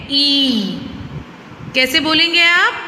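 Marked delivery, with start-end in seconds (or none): 0.1-0.7 ई held long with pressure; stressed long ई. Shown as a demonstration. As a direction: push out a long ई. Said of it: "थोड़ा सा जोर लगाते हैं". (जोर से लंबी ई)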